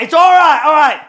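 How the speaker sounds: very high, loud shout; re-enacted yelled assurance. Brother fending off his sister, acted high yell. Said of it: "I'm like this to Megan".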